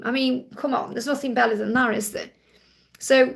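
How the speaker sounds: ordinary talk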